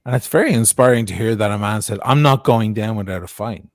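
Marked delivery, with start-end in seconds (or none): none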